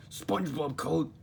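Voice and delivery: a lightly voiced cranky restaurant owner; Mister Krabs voice. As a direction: announce it like a put-upon boss. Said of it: "said Mister Krabs".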